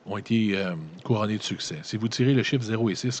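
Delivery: usual speaking voice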